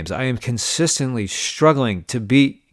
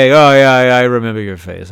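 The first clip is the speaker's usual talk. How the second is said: put-on loud drunk insistence; 8 dB louder than usual. Drunk guy insisting retold.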